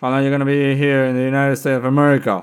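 loud, booming officer demand in English; loud officer question. Retold or imitated. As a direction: bark it at the nervous traveler. Officer barks question at traveler.

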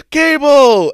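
a high, loud call of her name; calls out to her as she walks off; mock yell. Boy calling after the woman, mock yell. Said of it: "he goes".

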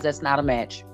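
ordinary talk, nothing marked

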